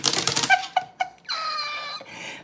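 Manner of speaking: a high laugh to hold back tears; laughing it off, close to crying; high tearful laugh. Real, not acted.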